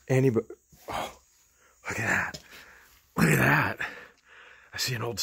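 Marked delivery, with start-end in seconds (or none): none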